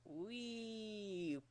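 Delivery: a soft rising wee; pitch slide upward. Shown as a demonstration. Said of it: "Your pitch is just like".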